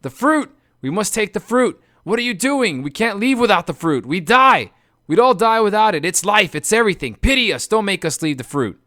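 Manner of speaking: high, loud desperate wailing; shrieking plea. Acted. Lotus eaters to their friends, shrieking pleas. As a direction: plead like an addict torn away. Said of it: "struggled and pleaded and shrieked".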